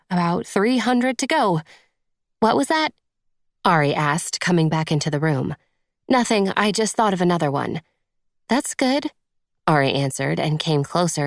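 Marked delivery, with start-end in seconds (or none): 2.4-2.9 friend's voice, higher and curious; a friend asking what she said; Ari's voice. A narrator's reading. Friend wandering back in, lightly curious. 8.5-9.1 encouraging friend, higher pitched; Ari's voice. A narrator's reading. Friend encouraging the job hunt, higher and bright.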